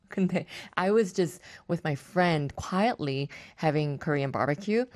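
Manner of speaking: ordinary delivery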